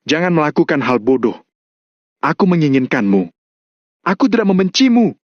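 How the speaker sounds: understated cry, rising high at the end; mild acted yell, pitch climbing on the last phrase; pleading shout. A narrator's reading. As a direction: plead urgently without much volume. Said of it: "memeluk Michelle dengan erat sambil berteriak".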